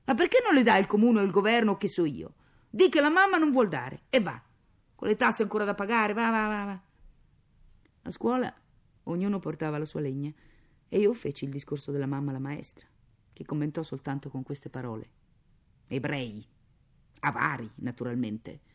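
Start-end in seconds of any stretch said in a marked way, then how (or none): 0.0-6.8 loud, higher exasperated tirade; indignant shouting. A narrator's reading. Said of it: "gridò lei". 15.9-18.6 low, cold disdain; contemptuous. Acted.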